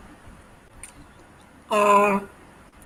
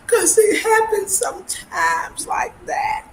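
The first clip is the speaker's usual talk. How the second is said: laughing high; 11 semitones higher, slower than usual. Real, not acted. Laughing about sweating bullets.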